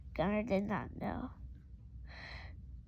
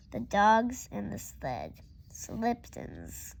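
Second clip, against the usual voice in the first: usual speaking voice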